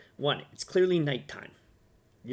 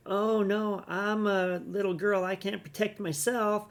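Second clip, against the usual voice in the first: put-on higher, slow helpless girlish voice; mock helpless little girl; 6 semitones higher, slower than usual. Mocking a woman who calls herself helpless, higher girlish voice. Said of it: "She's like".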